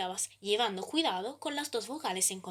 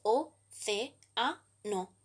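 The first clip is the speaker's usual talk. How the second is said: "o, ce, a, no" with a pause after each syllable; much slower than usual. Shown as a demonstration. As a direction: spell out "o, ce, a, no" as four separate beats. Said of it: "la división en sílabas es".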